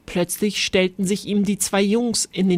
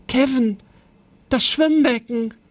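raised-pitch warning to a friend; urgent, higher storybook exclamation; alarmed call; 4 semitones higher, 3 dB louder than usual. A narrator's reading. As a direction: cry out in sudden alarm. Alarmed cry on spotting the pool.

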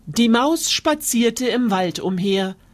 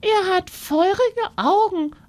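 mouse spinning a scary tale, much higher; boastful little mouse; 12 semitones higher than usual. Acted. Mouse scaring the fox with the monster, high and sly.

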